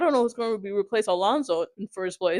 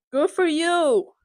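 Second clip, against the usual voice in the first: drawn-out, higher-pitched mock praise; sarcastic congratulation; 6 semitones higher, 3 dB louder, slower than usual. Real, not acted. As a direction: stretch it out high to brush off a troll. Mock congratulation to trolling rival fans.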